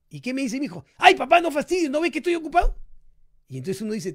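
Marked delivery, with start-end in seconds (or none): 1.0-2.8 high, loud whiny brush-off; a kid shooing dad away, higher and louder; annoyed child. Retold or imitated.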